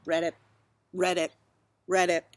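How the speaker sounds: lightly voiced frog croaks; a hint of bullfrog. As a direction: say it like a ribbit, in short separate bursts. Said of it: "And the frog looks at him and says".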